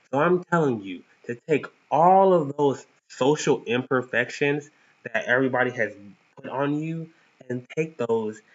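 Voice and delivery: usual speaking voice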